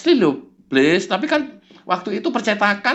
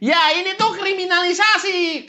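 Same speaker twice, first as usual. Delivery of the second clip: indignant high lawyer; 10 semitones higher than usual. Acted. Kurnia protests being reported, indignant and high. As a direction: play up the injured lawyer.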